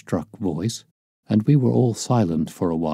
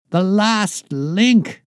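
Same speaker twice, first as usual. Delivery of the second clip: high, slow, triumphant cry; exultant cry; 12 semitones higher, 4 dB louder, much slower than usual. A narrator's reading. Holmes to the detectives, crying out in triumph. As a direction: crow over the final piece of the puzzle. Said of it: "he cried exultantly".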